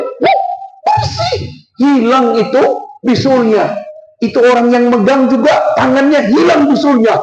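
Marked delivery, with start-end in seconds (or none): 0.0-1.4 high, astonished wah at the cure; amazed cry. Retold or imitated. Villagers amazed at the healed boils, high astonished cry. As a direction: gasp it out as if you can't believe it.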